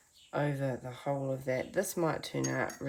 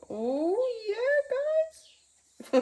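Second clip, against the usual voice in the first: much higher, louder delighted oh yeah; excited squeal; 7 dB louder than usual. Real, not acted. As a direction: burst out thrilled at the paint. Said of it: "sometimes I get excited".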